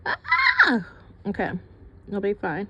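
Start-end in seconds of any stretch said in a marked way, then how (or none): 0.0-0.9 sudden high startled yelp; short shrill cry of surprise. Real, not acted. Startled by glaring light.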